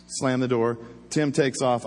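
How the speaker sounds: ordinary talk, nothing marked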